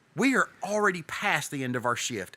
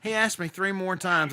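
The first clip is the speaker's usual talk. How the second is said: ordinary talk, nothing marked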